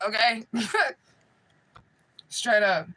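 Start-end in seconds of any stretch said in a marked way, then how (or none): none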